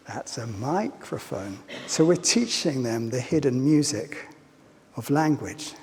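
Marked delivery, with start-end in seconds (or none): 0.1-1.6 put-on slower, softer, child-directed sing-song; slow soft voice for a small child; talking to a child. Shown as a demonstration. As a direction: say it gently, as to a toddler. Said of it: "But if I talk to a child, I would say".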